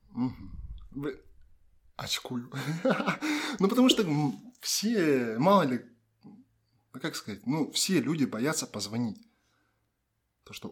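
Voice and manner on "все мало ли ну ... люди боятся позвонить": usual speaking voice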